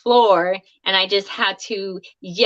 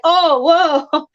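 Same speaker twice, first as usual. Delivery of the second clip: startled whoa; 7 semitones higher, 4 dB louder than usual. Retold or imitated. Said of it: "poor Brad was like".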